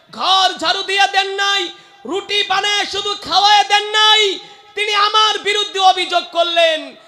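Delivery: high, insistent phrases swelling in volume; impassioned preaching. Acted. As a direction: build an acted lament into a loud sermon cry. Preacher acts out Omar at the grave.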